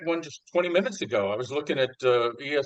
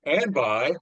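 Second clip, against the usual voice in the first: usual speaking voice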